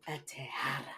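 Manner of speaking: low, soft mock Spanish twang. Co-host imitating a character's line, soft Spanish twang. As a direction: imitate a Spanish accent, dropped low and quiet. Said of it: "he had a little Spanish twang".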